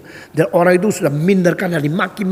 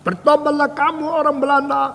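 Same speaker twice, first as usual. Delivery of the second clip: Indonesian street preacher; 11 semitones higher than usual. Retold or imitated. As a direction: imitate a preacher calling a crowd to repent. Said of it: "seorang Indonesia lagi berkhotbah".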